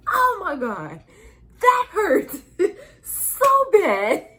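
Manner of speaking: very high, loud half-laughing wail; pained wail. Retold or imitated. Mother retelling the burning pain, loud high wail. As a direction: wail it like it still stings. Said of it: "And I was like".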